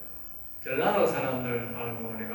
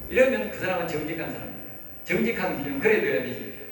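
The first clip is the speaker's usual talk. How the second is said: usual speaking voice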